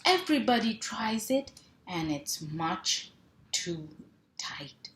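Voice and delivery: lively picture-book read-aloud with sound words; reading to children. Acted. Mom reading a truck book to kids, playful. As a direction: bounce the rhythm for little listeners.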